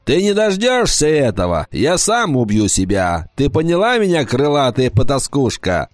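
shouting. Acted. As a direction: yell insults at the harpy. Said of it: "громко закричал".

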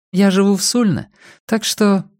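high feminine voice, left hanging; woman demurring. A narrator's reading. Woman answering the offer of a lift, high voice. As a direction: hesitate politely in a high woman's voice.

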